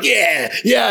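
mock in-your-face gloating; puffed-up boasting. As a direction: imitate a cocky scorer's celebration. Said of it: "like, puffed up".

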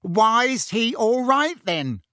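high, slow, indignant challenge; blustering. A narrator's reading. Uncle challenges Harry about his son, blustering. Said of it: "blustered Uncle Vernon".

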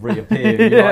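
high, loud laughing. Real, not acted. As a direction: talk through laughter. Laughing while retelling.

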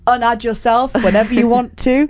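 high, teasing mock-harsh advice; mock mean. Real, not acted. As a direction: play it mean as a joke. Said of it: "That's why [name]'s being so mean".

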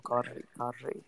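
"Cardi" softly, with a rolled R. Shown as a demonstration. Coaching her on the name, soft rolled R. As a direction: quietly roll the R in "Cardi". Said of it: "you can roll the R's in my name".

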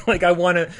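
high, loud burst of laughter; cracking up at the joke; laughing out loud at own answer. Real, not acted.

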